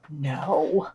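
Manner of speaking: whispered gasp of mock offense. Co-host teased for a personal reading, gasping in mock offense. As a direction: gasp as if scandalized, then breathe no.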